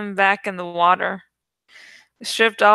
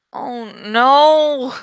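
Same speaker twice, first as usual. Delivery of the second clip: drawn-out dismayed groan; 4 semitones higher, 4 dB louder than usual. A narrator's reading. Jake groans at the lobster tank plan, dismayed. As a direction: groan at the bad idea. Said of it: "Jake groaned".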